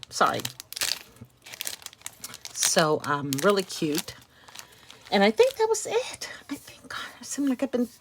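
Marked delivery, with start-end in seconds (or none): 5.1-7.9 breathy whispered aside; whispering. Real, not acted. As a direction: drop to a whisper. Sudden aside while wrapping up.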